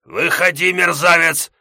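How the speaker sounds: high, loud furious roar; out of patience, roar it; furious yell. Acted. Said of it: "потерял остатки терпения Комтур".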